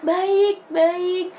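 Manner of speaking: high, loud eager answer; excited happy reply. Retold or imitated. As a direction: answer the friend brightly. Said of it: "Terus lu langsungnya".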